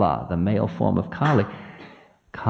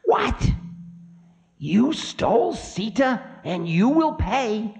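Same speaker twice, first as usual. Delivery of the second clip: high-pitched indignant outburst; shrieky high outrage; outraged; 13 semitones higher than usual. Acted. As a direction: accuse him, voice shooting up high. Time outraged in acted quarrel.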